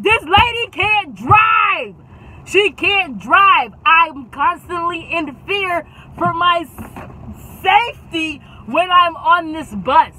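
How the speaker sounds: high, loud, drawn-out complaint; mad protest. Retold or imitated. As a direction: stretch each word in outraged alarm. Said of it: "I'm now mad at my friends".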